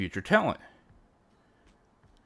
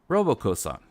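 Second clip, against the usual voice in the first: usual speaking voice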